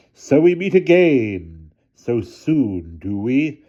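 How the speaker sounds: slow, high, mocking guard voice; sneering guard. A narrator's reading. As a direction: draw it out with a sneer. Guard confronts Hannah.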